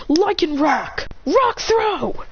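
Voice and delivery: high-pitched called-out move; attack command. Acted. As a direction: call the attack across the field. Trainer shouts attack command.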